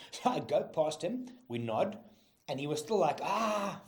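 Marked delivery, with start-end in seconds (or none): 3.2-3.8 high drawn-out mock wail; imitated cry of pain. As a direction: imitate someone howling through pain. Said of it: "from his pain".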